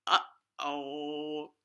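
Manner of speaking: ordinary delivery, nothing marked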